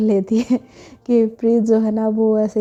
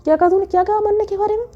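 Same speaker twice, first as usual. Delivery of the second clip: higher and fast, grabbing his collar; angry demand; 8 semitones higher, faster than usual. A narrator's reading. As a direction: demand it furiously, words tumbling. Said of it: "वो गुस्से में ना उसका collar पकड़ते हुए कहती". (गुस्से में तेज़ और ऊँची आवाज़ में)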